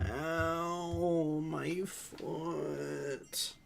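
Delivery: high, drawn-out pained moan. Real, not acted. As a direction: moan about the sore foot. Foot in pain.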